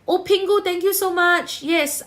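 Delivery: high, loud shout-out to a supporter; bright-pitched, excited livestream thank-you; delighted thanks. Real, not acted. Thanking a viewer for a gift.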